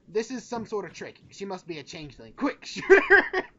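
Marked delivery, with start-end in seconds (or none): 2.3-3.4 high, loud, laughing yell; laughing shout. Acted. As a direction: shout it while cracking up. Jokingly urging to shoot her.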